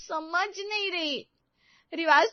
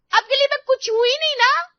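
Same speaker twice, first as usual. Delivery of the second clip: loud, high hurt shout; 9 semitones higher, 9 dB louder than usual. Acted. Sunita shouting at her sister-in-law, loud and high. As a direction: yell it out in frustration. Sunita चिल्ला रही है.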